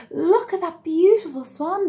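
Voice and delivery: high, loud admiring quack; excited quacking. A narrator's reading. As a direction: gasp it out in awe. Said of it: "they quacked".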